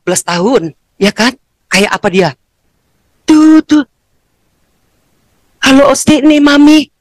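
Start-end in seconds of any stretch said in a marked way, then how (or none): 3.2-6.9 eager mother. Retold or imitated. Mother calling her son for the first time, high and eager. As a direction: greet your lost son at last.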